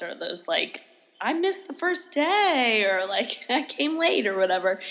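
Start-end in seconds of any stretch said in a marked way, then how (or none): 1.2-2.8 higher, slow mock student whine; lightly voiced sheepish student; sheepish student excuse. Quoting latecomer students' excuses. 3.5-4.3 lightly voiced sheepish student; sheepish student excuse. Quoting latecomer students' excuses.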